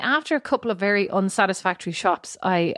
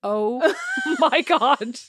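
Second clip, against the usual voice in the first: slow, higher, laughing outcry; laughing disbelief; 6 semitones higher, much slower than usual. Retold or imitated. Wife discovering Bo's order, laughing outcry. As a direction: drag it out through a laugh. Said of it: "I was like".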